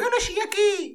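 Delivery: high put-on voice of a viral street interviewee; mock YouTube character. A vlogger mocking a viral interviewee, high mock voice. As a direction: squeak it like a clueless man on camera. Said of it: "daquelas personagens".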